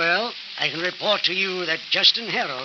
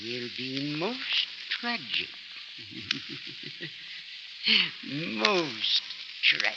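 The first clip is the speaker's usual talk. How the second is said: sinister threat; 6 dB quieter, much slower than usual. Acted. Professor to Linda, slow menacing threat. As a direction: savour the threat like a villain.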